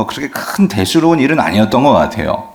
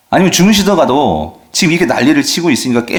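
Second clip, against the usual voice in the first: ordinary delivery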